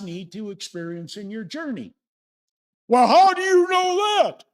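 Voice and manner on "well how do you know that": high, loud shouted challenge; doubter's objection. Acted. Speaker voices a sceptical listener, high shouted challenge. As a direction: shout it as a heckler would.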